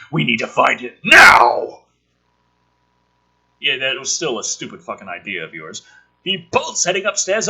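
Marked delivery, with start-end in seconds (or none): 0.1-1.8 loud crying shout; acted desperate shout. Father urging his wife to search, desperate shout. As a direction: yell it in a panic. Reading a desperate character line.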